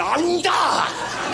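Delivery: high, strained comic cry; strain, then let out a high yelp; imitated yank-out noise. Imitates pulling nail out.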